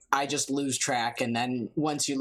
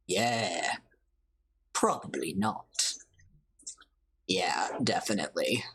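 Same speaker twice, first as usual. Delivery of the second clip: low murmured character voice; low-voiced game character; 9 semitones lower than usual. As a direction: drop right down for a laid-back reply.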